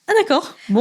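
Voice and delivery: high-pitched, surprised little acceptance; taken aback. Retold or imitated. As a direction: re-enact being caught off guard, pitch jumping up. Acting out her reaction to compliments. (surpris, voix haut perchée)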